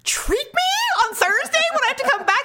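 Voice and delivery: melodramatic jealous questioning, climbing high; jilted lover. Retold or imitated. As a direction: play the betrayed lover for laughs. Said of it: "I feel like a jilted lover".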